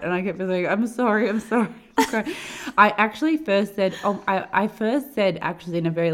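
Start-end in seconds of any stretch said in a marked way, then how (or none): 0.7-2.3 higher, louder imitation of crying apologies; mock tearful apology. Traveler retelling tearful apologies to airline man, mock tearful. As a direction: blurt repeated sorries, imitating a tearful outburst. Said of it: "I just burst into tears".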